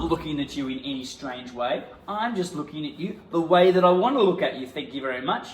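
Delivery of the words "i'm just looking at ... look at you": defiant. Pretend. Improvised quarrel, defiant.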